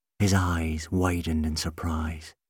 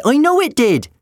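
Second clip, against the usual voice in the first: put-on high, loud, stubborn boy; insistent; 8 dB louder than usual. A narrator's reading. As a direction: push back with total certainty. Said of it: "Jim insisted".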